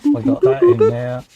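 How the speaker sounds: loud, high "We got that in there" in a put-on voice; mock triumphant shout; hammed-up loud voice. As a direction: bellow it in a silly voice. Sudden loud odd voice.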